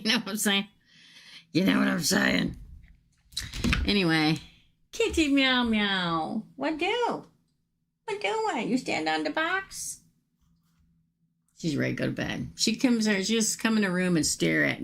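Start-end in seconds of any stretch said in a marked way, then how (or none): 4.9-10.0 high cooing pet talk to the cat; baby talk to cat. Acted. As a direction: coo at the kitty like a baby.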